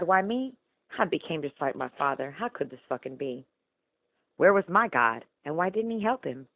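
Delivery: ordinary talk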